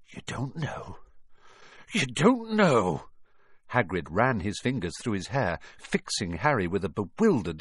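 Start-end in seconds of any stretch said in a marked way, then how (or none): 0.1-1.0 soft, higher, stunned murmur; stunned disbelief. A narrator's reading. Giant stunned by the news. 1.9-3.1 slow, raised, drawn-out disbelief; dismayed disbelief. A narrator's reading. Giant repeats it, more dismayed.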